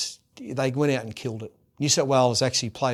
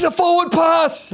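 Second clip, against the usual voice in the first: high, loud, hammed-up furious shout; Choppy's angry yell; 11 dB louder than usual. Choppy yelling at the referee's call, loud, high mock-furious shout. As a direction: bellow it like an outraged fan. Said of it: "He goes".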